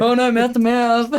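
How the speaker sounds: high, loud falsetto plea; mock damsel. Mate joins the joke, a falsetto damsel plea. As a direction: squeal it like a swooning damsel.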